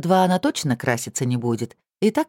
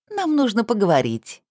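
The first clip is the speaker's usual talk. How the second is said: insinuating voice; 7 semitones higher than usual. A narrator's reading. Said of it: "вкрадчивым голосом сообщила она".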